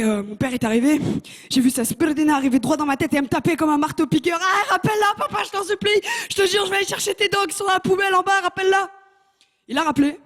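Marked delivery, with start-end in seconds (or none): 4.4-8.9 high, desperate begging; pleading child. Retold or imitated. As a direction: beg papa to call it back. Child begging father for mercy. (supplication d'enfant, très aiguë)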